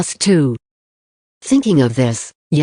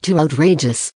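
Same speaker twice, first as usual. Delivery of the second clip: ordinary delivery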